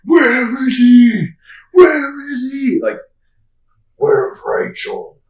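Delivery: loud, drawn-out strained hero growl; hammed-up slow superhero interrogation yell; Batman. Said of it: "talking about the Nolan trilogy".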